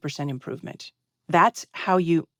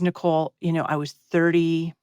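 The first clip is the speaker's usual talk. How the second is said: ordinary talk, nothing marked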